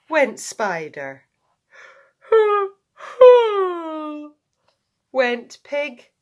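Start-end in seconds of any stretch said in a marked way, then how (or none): 3.0-4.3 loud high acted yawn; big noisy yawn. Pig yawns.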